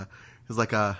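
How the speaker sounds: ordinary talk, nothing marked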